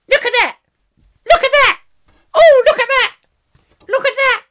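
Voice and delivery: very high, loud yappy excited cries; be an overexcited pointer dog spotting things. Acted. Host voices the family's pointer dog, squealing excitedly. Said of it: "their son who goes round".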